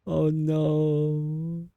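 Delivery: high, drawn-out wail of grief; mournful wailing. Real, not acted. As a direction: lament loudly in a high, stretched voice. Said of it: "I'm gonna cry".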